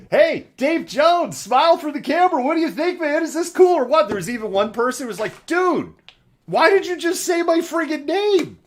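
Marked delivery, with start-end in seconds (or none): 0.1-4.1 high, excited bro calling out; excited streamer voice. Retold or imitated. Imitating streamer doxing friends. 5.5-8.6 high, indignant dude complaining; annoyed bro voice. Retold or imitated. Imitating annoyed rioter.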